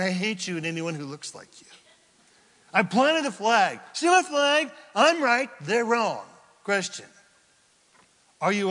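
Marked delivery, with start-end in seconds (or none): 2.7-6.2 louder, higher, smug insistence; stubborn boast. Acted. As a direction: plant the flag proudly. Acting stubborn flag planter.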